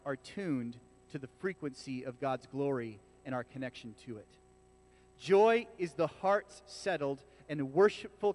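slow, deliberate poetic reading. Shown as a demonstration. Pastor reading a poetic quote to the church, slow and weighty. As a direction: let each phrase land slowly. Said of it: "I'm gonna read it slowly".

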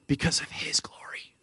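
near-whispered, soft emphasis; hushed reverence. Real, not acted. Pastor crediting God's glory to the church, hushed. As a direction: drop right down to a whisper.

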